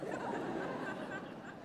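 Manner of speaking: laugh. Retold or imitated. Master's puzzled answer.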